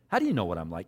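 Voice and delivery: doubting and sarcastic, dropped low. Acted. Nathanael doubting that Jesus knows him, sarcastic. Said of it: "even the sarcasm in his voice".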